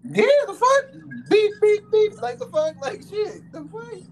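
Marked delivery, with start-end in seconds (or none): 1.3-2.2 1 censor bleep. Pretend. Censoring his own outburst.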